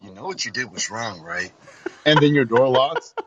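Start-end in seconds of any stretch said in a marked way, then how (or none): 0.0-1.5 softer, low-pitched scolding voice; car's voice. Pretend. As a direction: play a disembodied car voice, quietly reproachful. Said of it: "you just hear this voice go".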